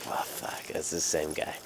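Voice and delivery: soft, whispered curse; whispered dismay. Retold or imitated. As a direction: mutter it under your breath. Dismay seeing the cop.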